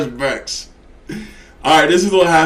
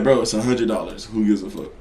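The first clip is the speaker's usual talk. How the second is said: self-calming; 8 semitones lower, 5 dB quieter, faster than usual. Retold or imitated. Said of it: "But I'm like".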